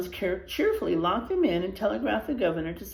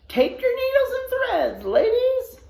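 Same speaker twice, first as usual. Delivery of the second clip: high, loud calling out orders; widow shouting; 6 dB louder than usual. Acted. Widow shouting instructions to the brides, loud and high. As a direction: holler it across the canyon. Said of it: "Widow Jones shouted instructions".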